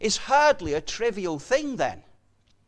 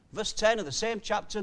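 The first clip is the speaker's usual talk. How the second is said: ordinary talk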